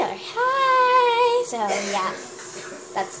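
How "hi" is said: bright, high-pitched greeting; a high, delighted hi; excited hi. Real, not acted. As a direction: squeal a happy hello into the mirror. Waves at mirror, sees brother.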